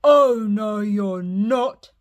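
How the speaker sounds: loud troll roar; loud troll refusing the little goat. Acted. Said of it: "roared the troll".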